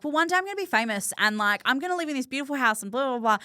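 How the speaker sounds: put-on high, fast kid's voice, dreaming big; childlike boast. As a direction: play yourself as a small child bragging about the future. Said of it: "I was like".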